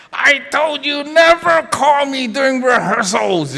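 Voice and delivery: high-pitched angry scolding; retold upset husband. Husband scolds his wife for calling mid-rehearsal, upset. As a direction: imitate him laying down the law, exasperated. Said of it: "he was so upset".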